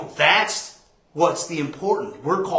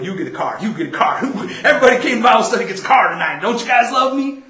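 loud, fast hammed-up giveaway host; mock Oprah giveaway; 5 semitones higher, 6 dB louder, faster than usual. Mock Oprah giving cars to the Bible study, building to loud and fast. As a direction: ham up a big prize show. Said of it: "I'm not gonna be Oprah".

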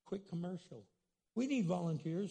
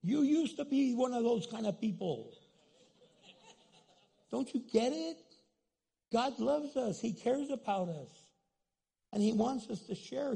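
higher, louder preaching; 4 semitones higher, 5 dB louder than usual. Acted. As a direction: drive it home to the pews. Preacher rebukes the congregation.

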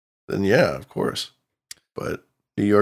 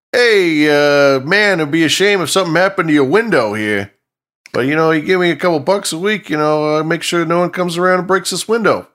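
higher, louder mobster shakedown voice; smiling menacing loan shark; 6 semitones higher, 3 dB louder than usual. Retold or imitated. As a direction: lean in like a friendly extortionist. Acting out protection racket shakedown.

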